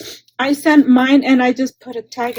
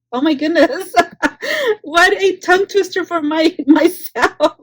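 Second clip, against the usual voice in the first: laughing at herself; 5 semitones higher, 4 dB louder than usual. Real, not acted. Laughing at her own tongue twister.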